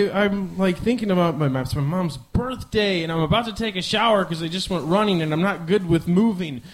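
ordinary talk, nothing marked

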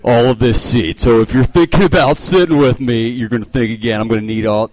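half-hearted territorial threat; mock glaring seat hog. The seat hog guarding his duffel bag, mock menace. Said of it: "he just gives you that nonverbal, that glare".

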